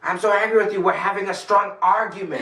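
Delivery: high, loud mock anger; angry. Shown as a demonstration. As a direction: ham up being furious.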